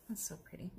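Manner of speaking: soft admiring whisper. Real, not acted. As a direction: whisper it to yourself. Whispered to herself.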